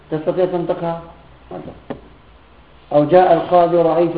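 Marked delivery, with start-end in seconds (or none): 1.5-2.0 whispered aside. Shown as a demonstration.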